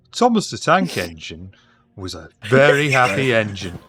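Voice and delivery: loud northern English storyteller; northern accent, story voice; northern narrator. Acted. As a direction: read it like a kids' train story in a northern accent. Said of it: "I don't know why I'm northern now either".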